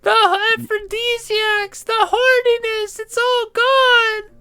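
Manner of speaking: tiny high wailing, slow; pixie. Acted. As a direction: wail for the dying babies, tiny and shrill. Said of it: "a tiny voice".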